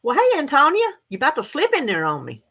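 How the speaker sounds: high, loud hello; cheerful greeting. Real, not acted. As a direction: greet her brightly. Greeting a chat viewer.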